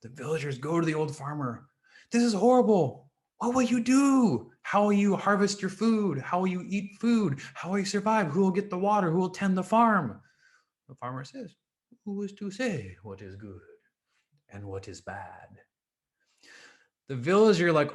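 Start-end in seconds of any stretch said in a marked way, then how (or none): 2.1-10.2 high, loud, worried questions; distraught villagers. Acted. Villagers fret to the farmer about his son's broken leg, loud and worried. 12.0-15.6 soft, unhurried, calm reply; calmly. Acted. Old farmer calmly answers the cheering villagers, soft and unhurried.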